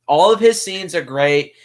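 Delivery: ordinary talk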